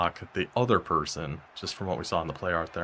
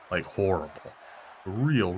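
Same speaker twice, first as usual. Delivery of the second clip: ordinary delivery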